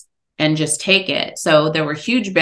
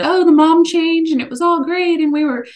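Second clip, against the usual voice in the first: higher, louder, sing-song mock of a happy ending; mocking fairy-tale voice; 11 semitones higher, 4 dB louder than usual. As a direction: send up the tidy happy ending. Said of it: "wrap things up in this nice little neat bow".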